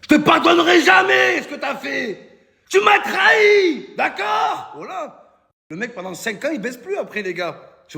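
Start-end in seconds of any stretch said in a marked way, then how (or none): 0.0-5.4 high, shouted mock indignation; stubborn betrayed friend. Stubborn pote refusing to forgive his cheating girlfriend, mock outrage. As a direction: wail it like a hammed-up wronged lover. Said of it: "ils font les têtus".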